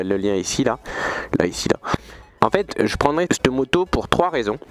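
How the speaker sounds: ordinary delivery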